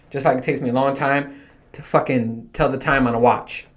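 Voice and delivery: normal talking voice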